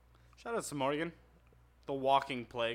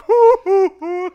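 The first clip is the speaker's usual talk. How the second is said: high, loud, laughing hoo hoo; Patrick whooping; 18 dB louder, slower than usual. Retold or imitated. Describing the meme, Patrick whooping high and loud. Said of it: "He's like".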